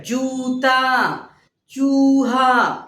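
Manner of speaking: Gita drawn out very slowly, syllable by syllable; slow classroom enunciation; slow teaching demo. Shown as a demonstration. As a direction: spell out Gita slowly for children. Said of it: "ऐसे लिखेंगे".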